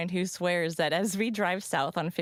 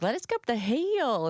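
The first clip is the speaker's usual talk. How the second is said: put-on South Carolina accent; 8 semitones higher than usual. Host as newcomer in Hawaii, thick South Carolina accent. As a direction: lay on a South Carolina twang. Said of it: "nobody could understand me in Hawaii".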